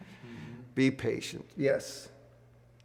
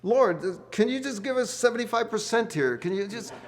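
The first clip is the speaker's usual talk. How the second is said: pleading with the Lord; 7 semitones higher, 5 dB louder than usual. Retold or imitated. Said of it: "I, I say".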